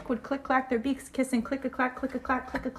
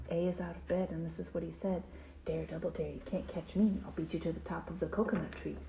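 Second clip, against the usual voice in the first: low, soft secretive telling; sneaky hush; 6 semitones lower, 7 dB quieter, faster than usual. A narrator's reading. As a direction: keep it down, a dare after bedtime. A sneaks out at night.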